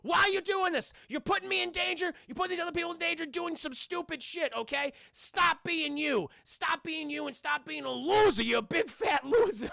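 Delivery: high, loud mock telling-off; imitating an exasperated shouted lecture; retold scolding. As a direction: berate a friend, leaning hard on each insult. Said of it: "like a puppy that was just scolded".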